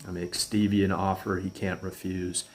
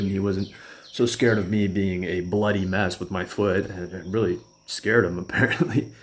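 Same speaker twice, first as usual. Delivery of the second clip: ordinary delivery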